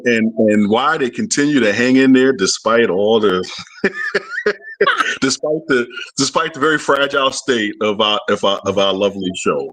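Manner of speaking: normal talking voice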